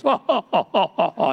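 high, repeated stuttering "oh"; John Corson impression. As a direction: imitate him with a string of oh-oh-ohs. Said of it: "when you listen to him today, he goes".

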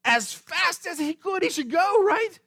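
high-pitched, emphatic exclamation; urgent insistence. Acted. Preacher tells the church how Jesus should respond, urgent insistence. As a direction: push it out high and incredulous. Mock-urgent answer to own question.

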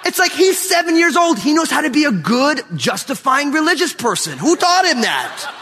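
high, loud laughing talk; laughing in disbelief. Real, not acted. Pastor tells congregation about son's answer, laughing high and loud. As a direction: laugh through it in amazement. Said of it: "I almost got in a car accident".

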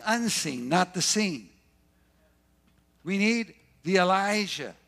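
loud, driving preacher's cry; preaching at full force. Real, not acted. Preacher urging the congregation, loud and driving. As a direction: pound each phrase to the crowd.

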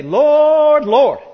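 loud high call of "Lord" falling on the second; false believers crying out loud "Lord, Lord"; emphatic cry. Retold or imitated. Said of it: "That's emphatic".

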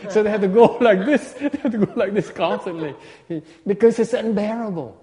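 can't stop giggling while miming the tail; laughing through it. Real, not acted. Monk laughs while showing how the buffalo swats flies.

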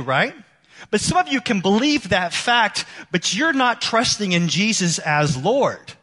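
loud, high pulpit preaching; forceful preaching. Acted. Pastor pressing the crowd, loud and high. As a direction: press the point hard on the congregation.

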